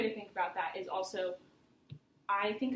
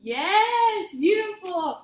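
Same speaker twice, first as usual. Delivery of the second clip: excited praise; 9 semitones higher, 11 dB louder, much slower than usual. Real, not acted. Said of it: "praising after exercise".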